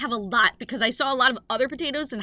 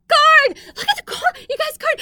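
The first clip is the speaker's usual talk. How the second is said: much higher, louder mock-excited cries of corn; excited yelling about corn; 11 dB louder than usual. Comedian hamming up a road-trip joke, excited yelling. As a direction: shout it like a kid spotting corn. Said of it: "we're all laughing hysterically".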